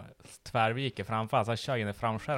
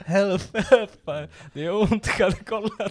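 mock plea for help; 10 semitones higher, 7 dB louder than usual. Bloodied friend begs for help.

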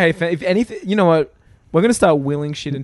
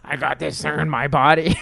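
weird Venom voice. Retold or imitated. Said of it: "That voice was so weird".

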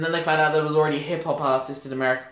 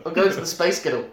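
Scottish accent; 2 semitones higher, faster than usual. Shown as a demonstration. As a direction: play it as a Scot. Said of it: "it's perfect".

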